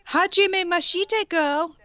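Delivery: a hammed-up cheerful anime girl; mock happy greeting. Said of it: "she said happily".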